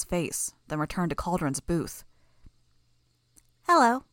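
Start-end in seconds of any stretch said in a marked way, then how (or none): 3.7-4.0 put-on high, loud little-girl greeting; a creepy child saying hello; eerie childish voice. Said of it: "Bonesaw's voice was eerie, childish".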